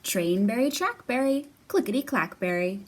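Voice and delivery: lively sing-song read-aloud; reading to children. Acted. Teacher reading Jamberry aloud to young kids. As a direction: bounce the rhymes for little listeners. Reads a picture book aloud.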